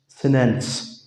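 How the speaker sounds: "सिनेंस" with N in place of T; American "सिनेंस", no T burst; American silent T. Shown as a demonstration. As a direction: drop the middle T, saying "सिनेंस". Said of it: "इस टी को silent कर देते हैं".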